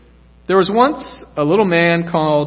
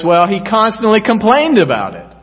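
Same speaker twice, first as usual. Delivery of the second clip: a severe judge, clearly louder and higher; 6 semitones higher, 6 dB louder than usual. A narrator's reading. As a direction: cut him down sternly. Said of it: "the severe voice says".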